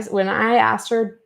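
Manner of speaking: ordinary talk, nothing marked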